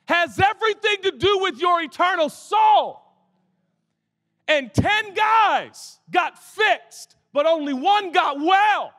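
preaching at full force. Acted. Preacher shouting to the congregation about the soul. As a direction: drive the point home to the congregation.

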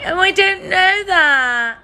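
loud, high whine with stretched words; mock whining. Player complains to the game host, whining. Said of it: "moaning and whining".

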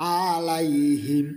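melodic recited word; light tajweed chant; recited slowly. Shown as a demonstration. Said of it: "একটা একটা করে অক্ষর উচ্চারণ".

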